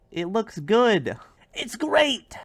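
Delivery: loud, rising insistence that soars high; high, emphatic enthusiasm; excited boasting. Acted. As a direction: sell it hard, pitch climbing. Showing off the building.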